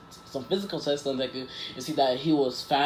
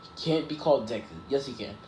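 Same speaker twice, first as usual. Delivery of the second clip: ordinary delivery, nothing marked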